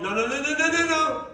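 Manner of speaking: self-restraining. Retold or imitated. Driver talking himself out of anger, hasty self-restraint. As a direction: catch yourself and back off hastily. Stopping own road rage.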